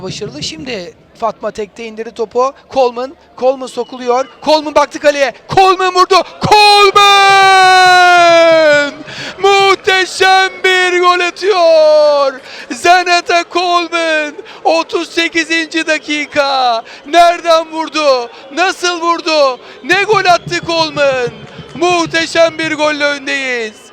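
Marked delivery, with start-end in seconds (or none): 4.4-14.3 long drawn-out goal scream, high and loud; screaming football commentator. Real, not acted. Goal scored, commentator erupts. 14.6-23.9 excited commentator. Real, not acted. After the goal, still elated.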